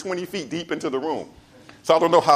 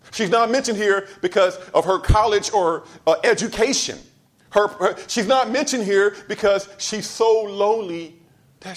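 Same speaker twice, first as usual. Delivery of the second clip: raised, higher-pitched sermon delivery; impassioned preaching; 7 semitones higher than usual. Acted. Preacher tells the congregation why Anna is in the Bible, impassioned preaching. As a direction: drive each phrase home from the pulpit. Preacher resumes the emphatic refrain.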